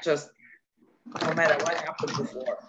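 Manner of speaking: usual speaking voice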